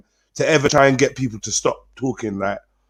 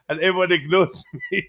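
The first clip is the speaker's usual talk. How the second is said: ordinary talk, nothing marked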